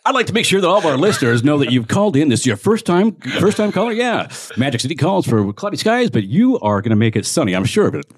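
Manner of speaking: loud upbeat radio host. Acted. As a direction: boom it out like a morning DJ. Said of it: "we're all gonna do a radio voice".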